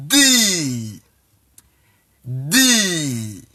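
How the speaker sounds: "D" as a long, falling deee; English letter name. Shown as a demonstration. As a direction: model "D" with a stretched ee. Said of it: "is the English alphabet D".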